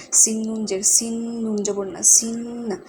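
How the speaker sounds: সিন্না with the n hummed through the nose; nasal gunnah. Shown as a demonstration. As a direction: hold the ন in সিন্না as a long nasal hum. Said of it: "এখানে নুনটা গুণনা হবে".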